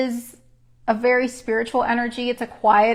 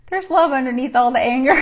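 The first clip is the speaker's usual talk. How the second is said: laughing through words; 3 semitones higher, 6 dB louder than usual. Real, not acted. Laughing at angry emojis.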